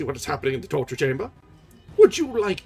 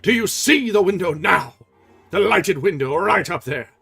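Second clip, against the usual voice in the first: louder, higher roar; roaring angry; 7 semitones higher, 10 dB louder than usual. Acted. Erik triumphant at Christine, roaring angry. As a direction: roar it at her. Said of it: "the angry voice roared".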